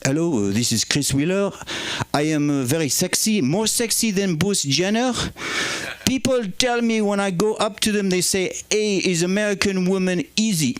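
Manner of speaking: mock-suave Frenchman boasting; comic French accent, cheeky and smug. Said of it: "French guy".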